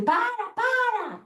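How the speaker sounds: high-pitched, drawn-out mock yell; imitated child's yell. Mother imitating her daughter protesting to grandpa, high mock yell. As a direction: imitate a kid crying out, high and stretched. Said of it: "ela, ela grita muito".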